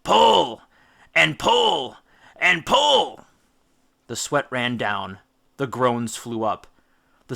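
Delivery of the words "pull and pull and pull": loud, high, punched rowing call; rhythmic shout. A narrator's reading. Odysseus beating rhythm for the rowers, shouting. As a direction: drive the oar stroke with each pull. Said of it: "beat out on the prow a rhythm to row by".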